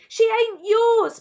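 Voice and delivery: anguished scream. A narrator's reading. A boy screaming at his father over his dead sister. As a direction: scream it out in raw grief. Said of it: "Bryce screamed".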